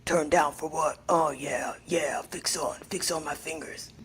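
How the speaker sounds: low, soft character voice; deep, hushed put-on moaning; Mingy. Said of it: "he would be like".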